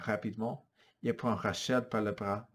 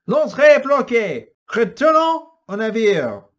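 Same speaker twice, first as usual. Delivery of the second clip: loud high command shout; 12 semitones higher, 15 dB louder than usual. Acted. Antoine shouting orders to his men, loud. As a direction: bellow the order to retreat. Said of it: "Il crie".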